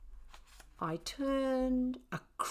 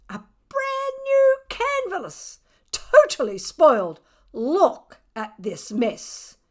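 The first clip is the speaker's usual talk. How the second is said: upset artist mum; 7 semitones higher, 9 dB louder, slower than usual. Acted. Owner upset about ruined canvas.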